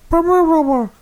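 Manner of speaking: high droning wah-wah noise; muffled Peanuts adult. Retold or imitated. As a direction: garble it like an unseen cartoon grown-up. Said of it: "like the adults on the ... Peanuts".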